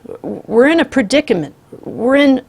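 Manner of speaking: high, loud stammering panic; cornered family, panicking aloud, shrieking; panicked shriek. A narrator's reading. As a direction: blurt it out in a frightened shriek. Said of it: "the grandmother shrieked".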